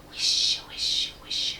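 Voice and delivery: unvoiced rustling whisper; trees whispering. A narrator's reading. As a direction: breathe the words like wind in leaves. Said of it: "said the trees loudly".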